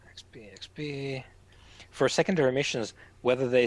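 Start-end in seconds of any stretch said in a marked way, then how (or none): 0.1-1.2 whispered muttering. Real, not acted. Muttering while checking sheet.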